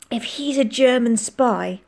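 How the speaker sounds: plain reading voice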